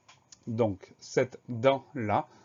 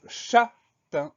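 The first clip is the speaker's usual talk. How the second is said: CHÂTAIN slowly split, chat then tain; syllable by syllable; 11 semitones higher, 6 dB louder than usual. Shown as a demonstration. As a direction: say châtain very slowly, each syllable separate and clear. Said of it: "Première syllabe".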